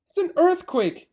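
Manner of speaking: cried out. Acted. Mrs Fox crying out to her family, high alarmed cry. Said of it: "cried Missus Fox".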